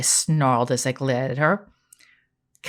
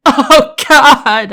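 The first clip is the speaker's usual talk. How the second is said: whine; 11 semitones higher, 12 dB louder than usual. Acted. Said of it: "I whined".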